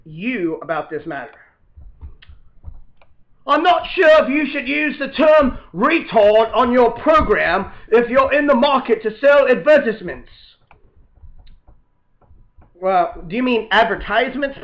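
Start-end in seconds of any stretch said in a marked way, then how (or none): 3.5-10.3 a nervous pundit warning the host; panelist voice. Acted. Panelist Paul, a put-on voice.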